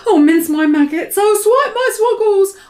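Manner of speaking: high, loud giant's wail; anguished cry. Acted. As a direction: wail in despair over the catch. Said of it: "His voice was filled with fury and anguish".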